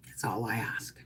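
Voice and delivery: a little whispered plea; soft whispered aside; whispered wish. Real, not acted. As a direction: whisper it, just a breath. Wistful aside.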